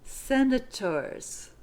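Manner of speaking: "Senators" slowly, ending on a buzzing z; slow "Senators" with a clear final z; model word. Shown as a demonstration. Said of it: "the last one is pronounced z".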